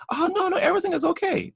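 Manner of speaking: high, fast mock cop brushing it off. Cop reassuring the homeowner he stopped, quick high mock voice. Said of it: "And he goes".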